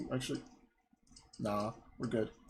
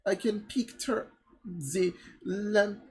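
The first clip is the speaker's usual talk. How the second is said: mock-dramatic French accent, slightly raised; 4 semitones higher, slower than usual. Woman picturing starving villagers, melodramatic French accent. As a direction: lament theatrically in a silly French accent. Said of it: "my voice just goes from French stereotype".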